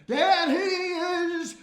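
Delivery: loud, high, very slow "There he is!"; drawn-out hearty greeting. Acted. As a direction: stretch "There he is!" out like a fanfare. Shopkeeper greets the boy.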